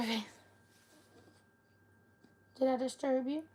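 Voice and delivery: ordinary talk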